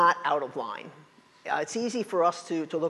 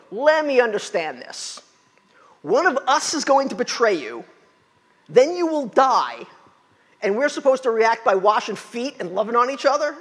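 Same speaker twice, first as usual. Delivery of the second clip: louder and higher, baffled disbelief; incredulous disciple; 6 semitones higher, 6 dB louder than usual. Retold or imitated. Pastor voicing a disciple baffled by the plan, loud and incredulous. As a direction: spell out the absurd plan in disbelief. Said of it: "I'm going".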